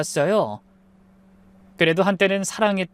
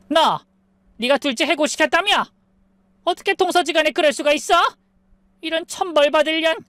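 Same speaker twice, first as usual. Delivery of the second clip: high-pitched angry accusation; angry mother-in-law; 9 semitones higher than usual. A narrator's reading. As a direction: lash out as a furious mother-in-law. Mother-in-law confronts the narrator at door.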